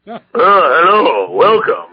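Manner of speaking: slow, drawn-out announcer voice, hammed up; teasing the host with a big on-air voice; mock radio voice. Teasing the host by copying his radio announcer voice, slow and grand. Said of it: "that was teasing".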